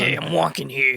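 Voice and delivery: classic New York Brooklyn street voice; Brooklyn accent. Retold or imitated. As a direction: Brooklyn cabbie yelling. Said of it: "real thick Brooklyn accent".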